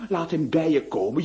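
ordinary talk, nothing marked

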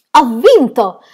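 loud, high victory cry; triumphant shout. Acted. Aunt shouting after reaching seven hundred five skips. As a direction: shout it like a champion. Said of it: "gridò allora".

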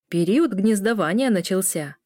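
plain reading voice

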